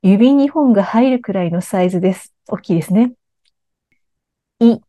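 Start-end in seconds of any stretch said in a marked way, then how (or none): 4.6-4.8 "い" as wide as for "a", half as tall; a distinct model "い" with a wide, narrowed mouth; clearly articulated vowel. Shown as a demonstration. Coach demonstrating vowel practice to learners, clearly articulated "い". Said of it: "あの口の横幅はそのままで".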